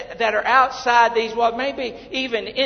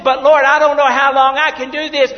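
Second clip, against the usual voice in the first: higher, louder whining protest; complaining to God; 5 semitones higher, 8 dB louder than usual. Retold or imitated. Preacher complaining to the Lord in a loud whine. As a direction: plead your case. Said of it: "preacher voices his complaint".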